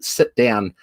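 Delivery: ordinary delivery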